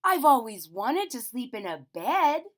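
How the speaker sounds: loud, high joyful wish; a bear's dream come true; excited bear voice; happy little bear. Acted. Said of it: "he said".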